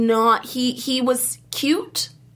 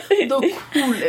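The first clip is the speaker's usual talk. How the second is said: laughing imitation of a botched Irish accent; mock Irish accent; slower than usual. Teasing a friend's botched attempt, in a mock Irish accent. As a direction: drag it out in a badly faked Irish accent.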